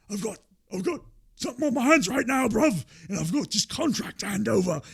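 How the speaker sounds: loose London-style impression, bruv and all; lightly sending up a British wrestler; mock British accent. Quoting Ospreay's British speech.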